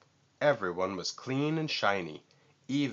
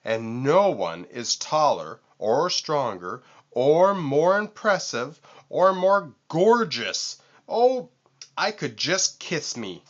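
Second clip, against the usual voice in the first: rising boast; 6 semitones higher, 7 dB louder than usual. A narrator's reading. Vulture raves at himself to Lion and Walrus, higher and louder. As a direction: boast climbing high and loud.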